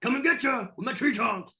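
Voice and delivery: high, hammed-up wrestling shout; pro wrestling hype. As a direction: belt it out like a mock wrestling promo. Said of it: "my origins of W W E and W C W".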